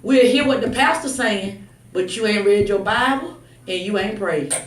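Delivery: ordinary talk, nothing marked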